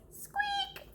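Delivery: tiny high mouse squeak; a quick squeaky mouse noise. Acted. Squeaking like a tiny mouse hidden in the house.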